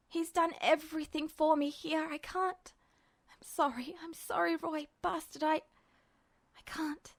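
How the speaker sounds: crumble into a tearful whisper; broken pleading. Acted. Ed apologizing to Roy, falling to a whisper.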